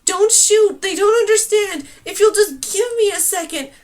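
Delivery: loud, high, pleading shouts; shouting to stop the archers; desperate shouting. A narrator's reading. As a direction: beg them at the top of your voice.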